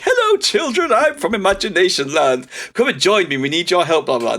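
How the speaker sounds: high sing-song welcome; cheery guide voice. Retold or imitated. Balloon man greeting kids in a high sing-song voice. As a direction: greet kids like a too-friendly stranger. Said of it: "He's just like".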